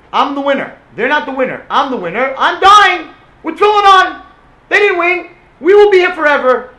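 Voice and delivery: high, very loud defiant cry, rising to the top; defiant screaming. Retold or imitated. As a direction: scream your victory at the crowd before dying. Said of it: "he started screaming to them".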